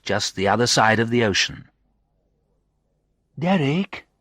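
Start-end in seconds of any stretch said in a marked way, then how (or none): 3.4-4.1 distant voice. A narrator's reading. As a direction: call the name as if from far away. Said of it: "thousands of miles away".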